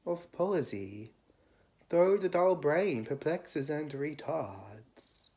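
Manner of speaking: measured, lifted poetry reading with pauses between phrases; poem recitation. Acted. Reader reciting Keats' ode to listeners, lyrical poem recitation. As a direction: recite it as verse, rising on the opening cries and sinking at line ends.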